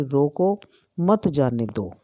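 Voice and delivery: "रोको, (pause) मत जाने दो" as two commands; pause after रोको. Shown as a demonstration. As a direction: break the sentence right after रोको. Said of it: "वो है विराम चिन्ह".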